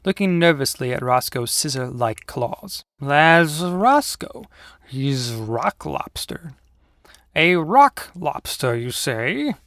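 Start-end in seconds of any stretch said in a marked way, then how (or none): 3.0-6.5 very slow, drawn-out uncle voice; slow uncle drawl; folksy uncle character. Acted. Uncle introduces Roscoe. 7.3-9.5 slow, pompous echo; slow, self-satisfied tiger voice; smiling paper tiger. Acted. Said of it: "with a broad, flat smile".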